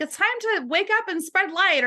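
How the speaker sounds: high-pitched angel voice. Retold or imitated. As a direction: voice a feminine archangel, up high and light. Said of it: "She had a high-pitched voice".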